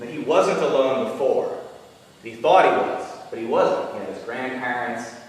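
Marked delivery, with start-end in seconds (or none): none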